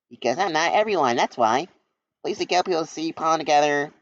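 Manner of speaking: high, perky little bee voice; cheeky bee. Acted. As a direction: buzz in brightly like a cheeky kid. Charmy the bee's lines.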